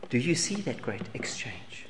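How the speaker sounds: soft whisper, almost no voice; whispered question. Real, not acted. Preacher asks the congregation again, now in a whisper. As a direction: hush it like a secret to the congregation.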